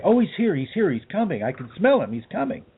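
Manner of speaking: high, swooping excited announcement; imitated excitement. Imitating the woman as she smells the saint coming, excited.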